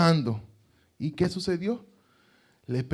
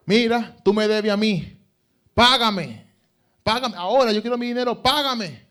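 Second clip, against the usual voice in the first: high, loud furious demand; angry creditor; 6 semitones higher, 5 dB louder than usual. Acted. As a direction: shout for your money, no mercy. Unforgiving servant demands money.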